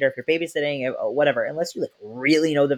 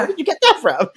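amazed; 13 semitones higher, 6 dB louder than usual. Retold or imitated. Puzzled at toddler's word.

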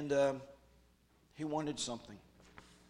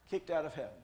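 ordinary delivery

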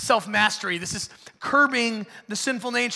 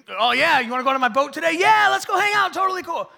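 much higher and louder, gushing yes-man; eager fair-weather friend; 11 semitones higher, 10 dB louder than usual. Pretend. Friend inviting you on his boat, hammed-up eager. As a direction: oversell the fun like a flaky buddy.

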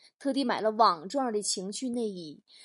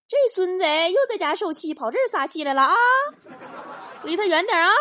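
wary thieves; 7 semitones higher, 6 dB louder than usual. Acted. Thieves warn each other.

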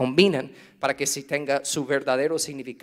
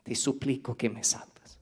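soft plea; 4 semitones lower, 11 dB quieter than usual. Retold or imitated. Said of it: "Y te gritas".

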